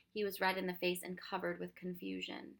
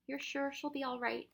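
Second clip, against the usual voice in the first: put-on higher, worried boy; anxious question; 6 semitones higher than usual. A narrator's reading. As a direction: ask with mild worry, pitch lifted.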